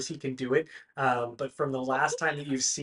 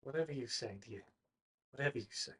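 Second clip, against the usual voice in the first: much softer, gentle placating; soothing humoring; 11 dB quieter, faster than usual. Acted. Paxton gently humors frightened Branwen, soft. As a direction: soothe her softly.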